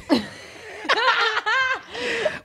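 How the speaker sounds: helpless high laughing fit; burst of laughter. Real, not acted. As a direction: crack up laughing. Cracking up at the quote.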